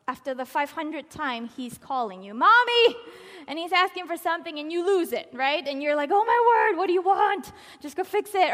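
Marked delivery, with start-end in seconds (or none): 2.3-3.0 put-on high, loud child's call; child calling mommy. 6.1-8.5 put-on louder, higher snapping at the child; exasperated mom. Exasperated parent losing it.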